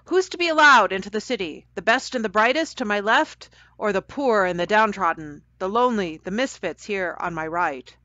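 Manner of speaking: loud judge's proclamation, easing towards the end; booming. A narrator's reading. Judge asks the children to choose, booming at first. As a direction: put the question to the whole courtroom. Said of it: "boomed the man with the wig".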